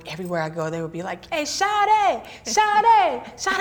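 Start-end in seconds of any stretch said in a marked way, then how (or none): 1.3-3.6 high, drawn-out Atlanta calling out; Atlanta accent. Retold or imitated. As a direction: mimic an Atlanta local calling shorty. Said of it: "that's how they say it".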